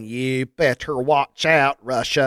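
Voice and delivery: slow, lightly voiced Irish accent, surly; a hint of a surly Irishman in an Irish accent. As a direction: growl a surly Irish warning, slowly. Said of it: "I could do an Irish accent".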